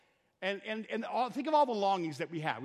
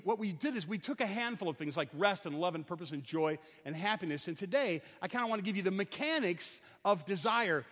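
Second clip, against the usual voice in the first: ordinary talk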